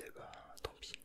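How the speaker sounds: normal talking voice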